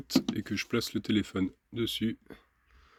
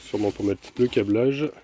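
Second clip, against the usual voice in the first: ordinary talk, nothing marked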